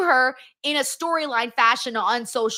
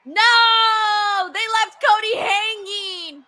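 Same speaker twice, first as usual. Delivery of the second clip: dismayed shout; 7 semitones higher, 4 dB louder, much slower than usual. Real, not acted. Streamer dismayed at Cody.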